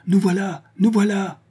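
appeler bien plus aigu, en criant; appel haletant. A narrator's reading. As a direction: crier pour rassurer de loin. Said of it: "proféra Honorine". (appel crié, bien plus haut)

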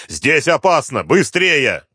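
high, urgent warning cry; shouted warning. A narrator's reading. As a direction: yell to hurry them out. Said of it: "снова крикнул Дронго".

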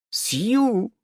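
high little squeak. A narrator's reading. Someone squeaking a name in his mind, high. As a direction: peep it timidly. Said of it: "пропищал кто-то".

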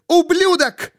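extremely high furious shriek; piercingly high cry of rage; screamed insult. A narrator's reading. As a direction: push the curse up into a scream. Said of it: "закричал рот Кары Свол".

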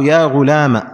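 "غلام" ending in a short -ma; final fatha. Shown as a demonstration. Teacher adding another example, "غلام" said ghulaama. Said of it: "هكذا بدون ألف".

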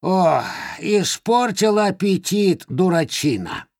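high, slow scolding crone; cackling Baba Yaga scolding; old witch voice. Acted. (сварливый голос старой ведьмы)

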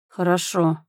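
mild, sighing consent; understated reluctant agreement; resigned sigh. A narrator's reading. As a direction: concede it with a light sigh. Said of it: "вздохнул я".

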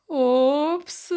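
high, startled exclamation; esclamazione sorpresa. A narrator's reading. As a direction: let it pop out high, caught out. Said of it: "esclama papà". (esclamazione acuta di sorpresa)

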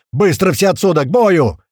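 loud, high shouted order; full-throated yell. A narrator's reading. Narrator clearing the hall at the alarm, yelling. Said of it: "заорал я во всё горло".

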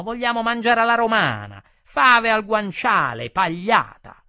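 loud, high-pitched yell; shouting. A narrator's reading. Customer demanding Roman food, shouting. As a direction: shout the demand over the whole table. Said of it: "gridò uno".

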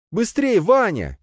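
high, stretched warning call; urgent cry. A narrator's reading. Princess to hero as portal closes, urgent cry. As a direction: cry out high to hurry someone. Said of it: "ударил крик принцессы".

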